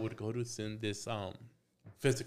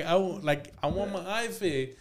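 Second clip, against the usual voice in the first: raised pitch, pleading indignation; retold insistent demand; 5 semitones higher, 5 dB louder than usual. Patient wanting the eye fixed, retold insistent demand. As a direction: re-enact a patient demanding treatment.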